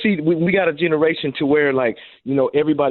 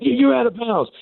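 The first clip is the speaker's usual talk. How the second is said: mocking coach; 11 semitones higher than usual. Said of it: "the coach was like".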